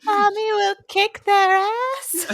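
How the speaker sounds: high, loud, slow kid impression; exaggerated, drawn-out child voice; adorably sheepish. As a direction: belt it out like a cute little kid. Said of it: "says it so adorably and sheepish".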